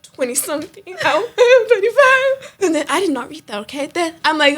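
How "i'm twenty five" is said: slow, high, laughing; breaking up with laughter. Real, not acted. Joking about her test answer, laughing hard.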